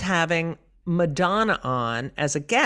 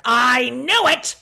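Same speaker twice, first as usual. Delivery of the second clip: slow, louder I-knew-it; mother's gotcha cry; 7 semitones higher, 5 dB louder, slower than usual. Retold or imitated. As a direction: crow it like a mother who's caught you out. Mother catching her lie.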